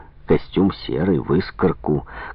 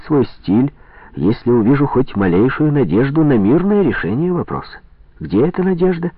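heated; 7 semitones higher than usual. A narrator's reading. Heated political argument.

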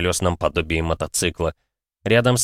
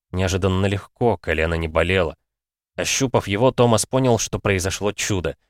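ordinary delivery

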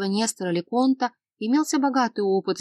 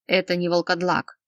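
usual speaking voice

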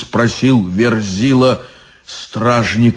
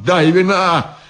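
put-on drunk guard's plea; 6 semitones higher, slower than usual. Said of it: "drunk guard's outburst".

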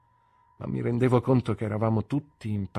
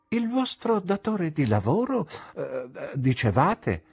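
gentle mister Dick; 8 semitones higher than usual. A narrator's reading. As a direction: nudge him kindly back to it. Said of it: "fece mister Dick". (mister Dick, dolce e insistente)

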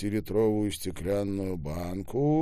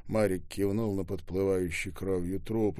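usual speaking voice

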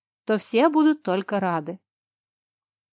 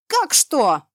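high, loud indignant cry; indignant outburst; 6 semitones higher, 6 dB louder than usual. A narrator's reading. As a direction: burst out in outrage. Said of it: "возмутился он".

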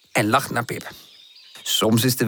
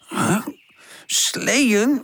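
drowsy, questioning rise; half-awake puzzled murmur, pitched high; sleepy mumble; 10 semitones higher than usual. A narrator's reading. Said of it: "mompelt hij". (slaperig, vragend gemompel)

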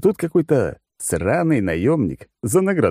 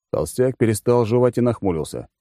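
usual speaking voice

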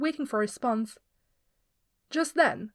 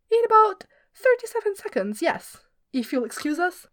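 cheeky reply; 8 semitones higher, 3 dB louder than usual. Acted. Glorfindel cheeky reply to boss.

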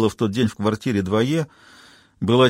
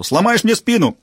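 much higher, louder furious yell; 10 semitones higher, 4 dB louder than usual. A narrator's reading. Rollo yelling at Jane pinning him down. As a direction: scream it from under her. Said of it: "орал Ролло".

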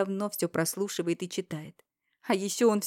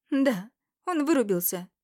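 higher, louder, fast female voice; Irina's urgent revelation; 6 semitones higher, 6 dB louder than usual. Acted. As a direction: blurt out the truth. Ирина перехватывает. (взволнованная Ирина, выше и громче)

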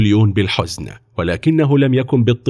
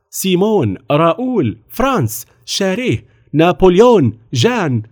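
higher-pitched roll call of names; children calling out; 9 semitones higher than usual. A narrator's reading. Boys giving their names to the officer, calling out. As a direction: call each name out in turn. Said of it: "وتعالت الأصوات".

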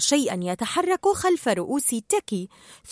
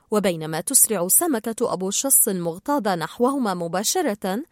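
plain reading voice

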